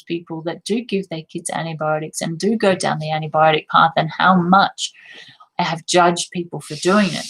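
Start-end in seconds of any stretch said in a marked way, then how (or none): none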